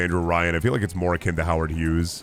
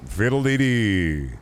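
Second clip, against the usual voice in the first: slow, drawn-out catchphrase, less high; catchphrase bit; 3 semitones higher, slower than usual. Acted. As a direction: stretch it out at a modest pitch, falling away. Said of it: "And I be going".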